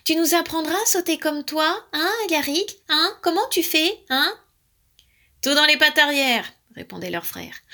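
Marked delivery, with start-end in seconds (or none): 0.0-4.4 high, eager pestering; admiring. A narrator's reading. Little brothers begging Alaric for lessons, admiring. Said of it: "étaient pleins d'admiration". 5.4-6.5 high, loud instruction; confident tip. A narrator's reading. Alaric answering his admiring brothers, confident. Proudly quoting the frog's tip.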